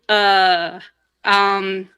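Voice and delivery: drawn-out, searching filler sounds; stumped hesitation. Retold or imitated. Grandfather struggles to explain his tattoo to a child, stumped hesitation. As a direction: imitate someone lost for an explanation. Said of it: "he had really hard trouble explaining it".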